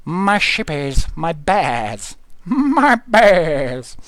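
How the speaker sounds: drawn-out, lightly put-on cooing; playful silly voice. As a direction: stretch each word in a goofy voice.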